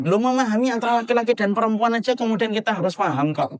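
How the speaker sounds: ordinary talk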